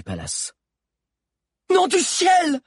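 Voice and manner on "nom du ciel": very high, loud sudden howl; high-pitched appalled shout; horrified yell. A narrator's reading. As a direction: scream out in shock. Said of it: "hurla soudain Zizo".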